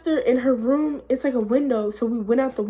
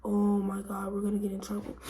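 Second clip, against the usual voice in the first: hushed, softer mock worry; worried friends; 8 dB quieter than usual. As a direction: imitate friends fretting under their breath.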